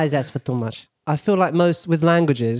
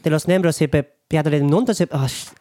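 mock Spanish. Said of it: "front of house at a Spanish restaurant in Barcelona".

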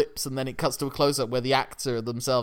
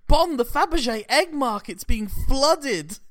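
posh panicking boss; 12 semitones higher, 5 dB louder, slower than usual. Retold or imitated. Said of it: "it's like".

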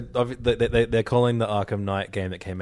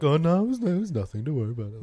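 high-pitched dismissive brush-off; playing it off; 6 semitones higher than usual. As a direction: imitate someone breezily waving it away, pitched up. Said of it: "he played it off like".

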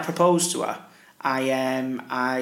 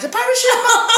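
high laughing voice on the reveal; 6 dB louder than usual. Retold or imitated. Telling girlfriend the prank, high laughing reveal. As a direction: squeak it out through laughter. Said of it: "I went".

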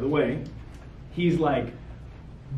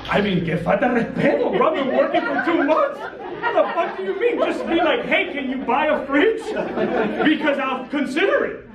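higher, laughing indignant outburst; exasperated rant; 6 semitones higher than usual. Real, not acted. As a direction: vent with disbelieving laughter.

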